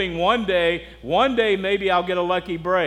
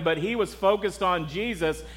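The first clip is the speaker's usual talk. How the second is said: normal talking voice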